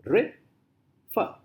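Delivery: "refer" in two separate beats, re, fer; split into syllables. Shown as a demonstration. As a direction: break "refer" apart with a gap between syllables. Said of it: "two syllables".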